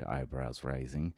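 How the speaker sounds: plain reading voice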